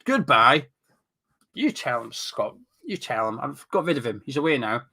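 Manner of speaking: ordinary talk, nothing marked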